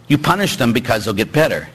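usual speaking voice

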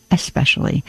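ESPECIALLY as "es-pecially", softly, no K sound; correct S pronunciation. Shown as a demonstration. Host repeating the right word, careful S in ESPECIALLY. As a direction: say ESPECIALLY with a clean "es" opening. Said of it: "there is no X in especially".

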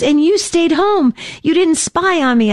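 put-on high, pleased kid voice; a child checking mom kept her word; proud little boy. Son proud, teasing mom.

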